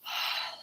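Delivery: soft voiceless exhale; breathy sigh. Real, not acted. Vlogger needing a break, deep sigh. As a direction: let out a long quiet breath.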